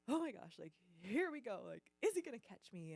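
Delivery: alarmed, loud self-talk mid-fall; acted free-fall panic. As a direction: relive the scare of dropping.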